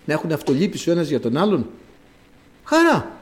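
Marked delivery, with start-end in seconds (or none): 2.6-3.1 high ringing exclamation of joy; joyful cry. Real, not acted. Preacher exclaiming joy to the congregation.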